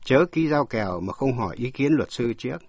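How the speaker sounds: normal talking voice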